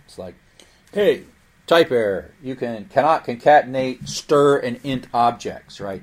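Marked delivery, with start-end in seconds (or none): 0.9-5.8 loud, hammed-up scolding of a programmer; mock scolding. Python scolding the student for a type error, loud mock telling-off. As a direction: bark it out like a stern scold. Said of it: "you're being scolded".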